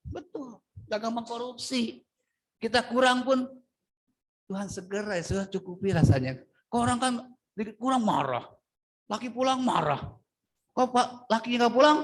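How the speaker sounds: ordinary talk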